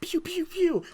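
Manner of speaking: very high pew pew zaps; high kid blaster sound effects; toy blaster noises. Pretend. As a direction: make laser sounds like a kid playing. Tony toy making blaster noises.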